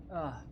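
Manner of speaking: a sudden real sneeze; sneezing. Real, not acted. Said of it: "sorry about the sneeze".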